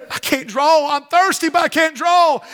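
hammed-up helpless complaint; acted protest. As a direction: plead it up high, dramatised from the pulpit. Voicing the woman's protest at well.

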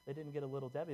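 usual speaking voice

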